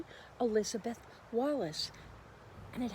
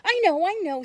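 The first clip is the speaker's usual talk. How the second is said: high, loud eager hand-raising; eager child; 9 semitones higher, 12 dB louder, faster than usual. Acted. Minna eager to answer the teacher, high excited. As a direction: burst out like a keen pupil. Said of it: "said Minna".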